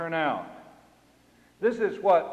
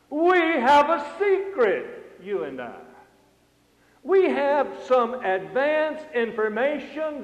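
forceful preaching; 4 semitones higher, 5 dB louder than usual. Acted. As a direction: proclaim it with mounting emphasis.

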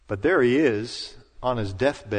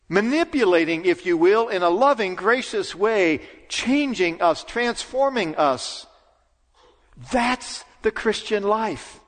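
raised, fervent pulpit preaching; 9 semitones higher than usual. Real, not acted. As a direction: drive it home from the pulpit. Preaching at full force.

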